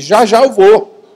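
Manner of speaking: drawn-out, high, loud call; mock shout from another room; retold yell. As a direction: holler it as if to the next room. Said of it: "Eu gritei lá da sala".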